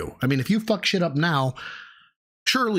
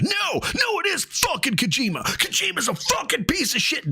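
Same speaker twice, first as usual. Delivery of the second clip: much higher, strained shouting; mock blind rage; 12 semitones higher than usual. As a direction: rant at the developer in hammed-up fury. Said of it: "That is blind rage".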